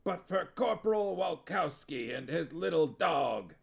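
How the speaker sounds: lightly voiced raspy sergeant; hint of a gruff war-film sergeant; rasped sergeant line. Huel playing war-film sergeant to witches, light rasp.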